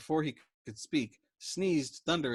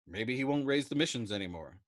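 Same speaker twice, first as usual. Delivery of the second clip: ordinary delivery, nothing marked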